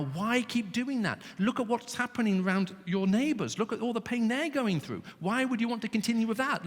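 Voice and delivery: high, earnest pleading voice. Acted. God pleading with his people to turn back, high earnest plea. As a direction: beg them to stop hurting themselves. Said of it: "He is pleading with his people".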